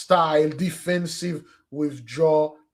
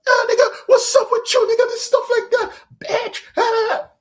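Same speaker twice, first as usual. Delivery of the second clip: much higher, loud, laughing caricature; exaggerated street talk; 5 dB louder, faster than usual. Shown as a demonstration. Preacher showing the exaggeration to avoid, much higher laughing caricature. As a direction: overplay it like a loud joker. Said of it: "the exaggerated gesture".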